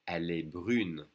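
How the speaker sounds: brune with a French U and a held N; pronounced N. Shown as a demonstration. Teacher modelling the form for a girl, pronounced N. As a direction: sound the N at the end of brune. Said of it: "we do pronounce the N".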